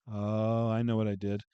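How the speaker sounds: ordinary talk, nothing marked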